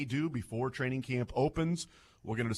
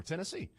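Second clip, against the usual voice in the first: Tennessee said softer, rising onto SEE; last-syllable stress; 7 semitones higher, 6 dB quieter than usual. Shown as a demonstration. Host offering the alternative, last-syllable stress on Tennessee. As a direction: lift the final syllable of "Tennessee". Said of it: "the last syllable is where you have the emphasis".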